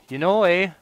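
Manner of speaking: hammed-up Canadian accent, high and loud; mock his own Canadian accent with a big "eh". Shown as a demonstration. Gamer teasing his habit, in a Canadian accent. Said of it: "doing that damn Canadian accent".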